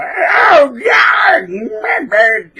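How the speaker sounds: loud, high cartoon yelling; hammed-up shrieks of a squabble; mock fighting. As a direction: screech like two people brawling. Acts two people fighting.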